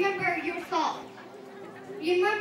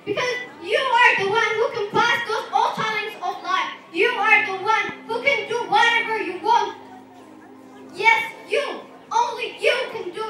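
louder, fervent motivational push; rousing exhortation; 3 semitones higher, 5 dB louder than usual. Real, not acted. Speaker telling listeners they can pass life's challenges, rousing exhortation. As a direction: drive it home to the crowd, stressing key words. Motivational speech climax, urging audience.

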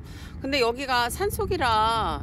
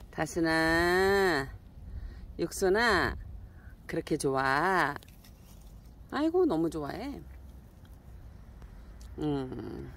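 usual speaking voice

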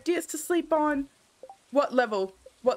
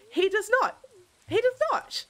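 high emphatic he does not; indignant scoff; 9 semitones higher than usual. Real, not acted. Taunting Harvey about the mines, high scoffing insistence.

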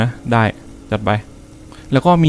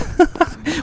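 high-pitched laugh after a scare; startled laugh; 5 dB quieter than usual. Real, not acted. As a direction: burst of shrieky, relieved laughter.

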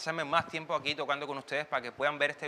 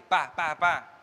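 hard hits; 6 dB louder, much slower than usual. Retold or imitated. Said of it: "te estás dando en tus partes".

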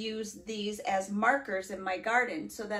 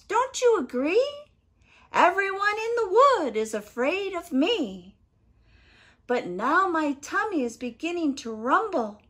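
high, loud little-creature boast; triumphant mouse; 6 semitones higher, 8 dB louder than usual. Acted. Mouse taunts the Gruffalo, high and cocky. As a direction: press the advantage in a piping voice.

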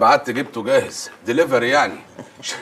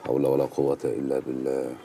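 solemn low lament; 11 semitones lower, 7 dB quieter than usual. Acted. Repeats lament.

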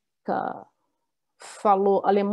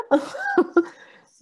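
laughing; 13 semitones higher than usual. Real, not acted. Laughs at student's answer.

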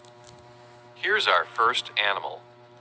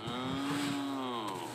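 cow moo; 4 semitones higher, 10 dB quieter than usual. Pretend. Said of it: "A cow".

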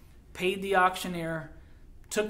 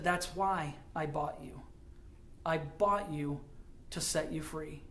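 slow, much softer, tender; tender reveal; 3 semitones lower, 9 dB quieter than usual. Acted. The miner quietly telling the girl why he bought her. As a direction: let it land gently and slowly.

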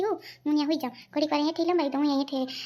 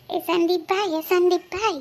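calling out; 4 semitones higher, 6 dB louder than usual. Acted. Calling out across the house.

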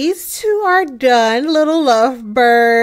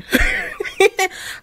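a short high burst of laughter; 4 dB quieter than usual. Real, not acted. Laughs at own insistence.

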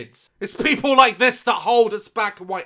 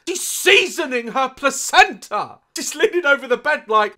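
ordinary delivery

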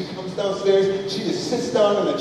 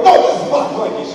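high, loud, fast protest; petulant retold outburst; childish refusal; 8 semitones higher, 7 dB louder, faster than usual. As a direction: whine back possessively like a kid.

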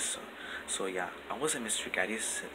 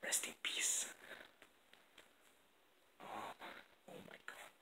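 whispering. Real, not acted. Whispering at night in the house.

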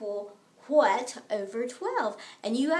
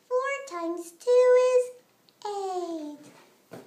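higher, louder and slow, building suspense; excited build-up; 6 semitones higher, 4 dB louder, much slower than usual. Acted. Teacher building suspense before the answer. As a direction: stretch it out before the reveal.

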